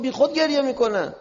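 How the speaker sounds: high, loud dismissal; heated comeback from a retold quarrel; retold angry retort.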